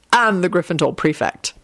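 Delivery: usual speaking voice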